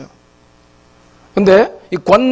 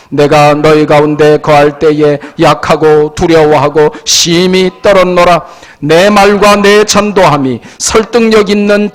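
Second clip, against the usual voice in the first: loud, declamatory Bible recitation; congregational scripture reading; 6 dB louder than usual. Real, not acted. Preacher leading congregation in Corinthians, loud measured reading. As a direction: project each phrase firmly for all to follow.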